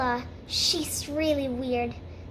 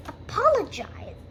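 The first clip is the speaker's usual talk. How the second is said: higher-pitched affronted question; incredulous echo; 6 semitones higher than usual. Acted. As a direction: throw the word back in disbelief. Rachel echoes the demand, affronted.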